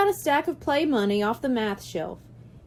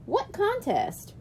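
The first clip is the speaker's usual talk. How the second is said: put-on curious kid; 9 semitones higher, 3 dB quieter, slower than usual. A narrator's reading. Kid asking.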